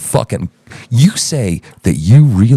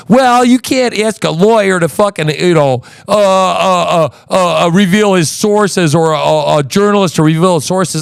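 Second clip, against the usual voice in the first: higher-pitched scornful mimicry; mocking quote; 5 semitones higher than usual. Host parrots the guest's excuse about sources, mockingly. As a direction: parrot his excuse with contempt. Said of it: "This bullshit that he's".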